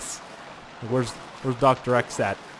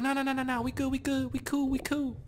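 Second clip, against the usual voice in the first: high, softer placating voice; backing-down shark; 13 semitones higher, 6 dB quieter than usual. Pretend. Player voicing a shark backing out of a fight, high placating voice. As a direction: back off fast in a high, quieter voice. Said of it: "He's like".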